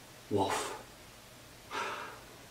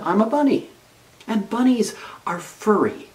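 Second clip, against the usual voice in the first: higher, louder bright reassurance; cheerful reassurance; 10 semitones higher, 5 dB louder than usual. A narrator's reading. Bunny cheering up the frightened mouse, high and bright.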